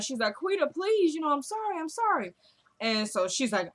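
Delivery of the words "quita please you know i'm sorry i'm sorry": high-pitched imitation of begging; whiny mock-crying apology; tearful pleading. Exposed woman begging her boss, imitated tearful plea. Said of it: "she's crying and all that".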